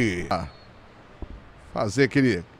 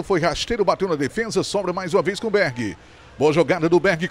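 usual speaking voice

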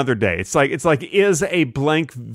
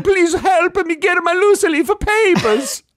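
Italian chef. Acted. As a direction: bellow it like a panicked cartoon chef. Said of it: "Mamma mia".

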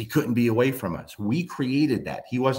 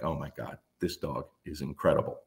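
hushed awe; 7 semitones lower, 7 dB quieter than usual. Retold or imitated. Amazed at the dog.

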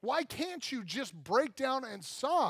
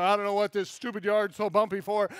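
grumbling at the yard; 6 dB louder than usual. Retold or imitated. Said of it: "I'm out there".

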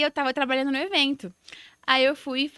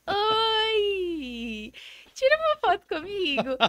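high, drawn-out bashful "oi"; hammed-up embarrassment, squeaky-high and stretched out; mortified shyness; 7 semitones higher, much slower than usual. Fan asking her idol for a photo, acting dead embarrassed. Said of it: "quase morrendo de vergonha".

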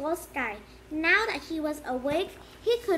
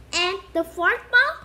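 eager; 4 semitones higher, 8 dB louder than usual. Acted. Colin eager question.

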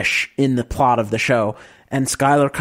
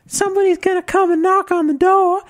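camp it up as a fretful Southern wife; mock Southern belle. [name] playing Skyler opposite Walt, high mock Southern voice. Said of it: "you be Skyler and I'll be Walt".

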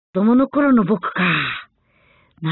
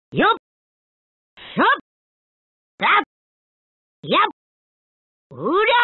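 short, sharply rising fight grunts; attack shouts; much faster than usual. Acted. Fighter throwing blows, short rising attack cries. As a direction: punch out separate attack cries.

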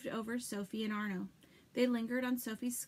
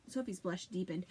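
ordinary delivery, nothing marked

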